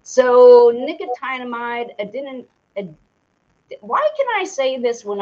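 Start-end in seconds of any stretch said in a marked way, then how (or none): none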